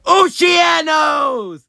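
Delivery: high, loud, drawn-out cry; excited shout. Real, not acted. Spotting a favourite ship, excited shout. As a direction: call out the ship name in delight. Excitedly naming ships found.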